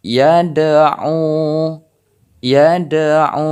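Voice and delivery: "yad'u" with the d bouncing; qalqalah on dal. Shown as a demonstration. Teacher demonstrating qalqalah, bounced dal. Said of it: "harus terdengar pantulan".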